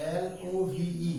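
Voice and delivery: teacher spelling slowly to show the difference. Shown as a demonstration. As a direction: spell each letter very slowly. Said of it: "ডিফাৰেন্সটো চোৱা".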